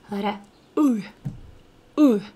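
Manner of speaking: U drawn from the back of the mouth, lips not spread; isolated U, held as a model sound; unsmiling Russian vowel. Shown as a demonstration. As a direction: say U without smiling. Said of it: "we do not smile".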